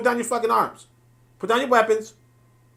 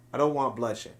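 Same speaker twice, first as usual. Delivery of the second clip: "I don't want bloodshed" said much lower and softer, hushed and mild; soft mock plea; 9 semitones lower, 8 dB quieter than usual. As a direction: murmur "bloodshed" gently, like a fake peacemaker. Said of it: "and you say to them".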